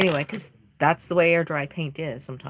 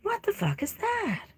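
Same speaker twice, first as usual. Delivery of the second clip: startled; 3 dB quieter than usual. Retold or imitated. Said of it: "Excuse my language".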